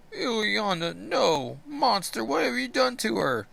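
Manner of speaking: high, pained demand of a brother; horrified accusation. Acted. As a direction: cry out at the monster. Colossus voiced.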